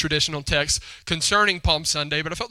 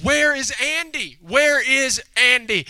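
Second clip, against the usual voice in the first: put-on higher, slow, drawn-out child's demand; insistent little girl; 8 semitones higher, 5 dB louder, slower than usual. Little girl presses her brother about Andy, insistent and drawn out. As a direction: imitate a child pressing for an answer.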